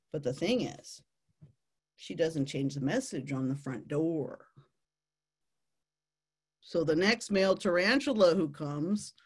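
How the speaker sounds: normal talking voice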